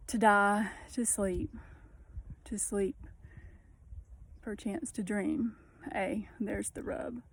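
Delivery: slow, drawn-out dreamy reading; slow poem recitation. Acted. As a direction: let each phrase hang before the next. Reads soliloquy aloud.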